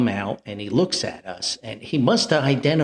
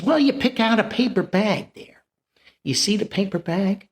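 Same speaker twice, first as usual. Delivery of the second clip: peddler voice; 8 semitones higher than usual. Retold or imitated. Peddler explains the deal.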